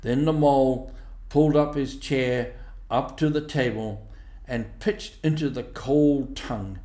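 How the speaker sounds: plain reading voice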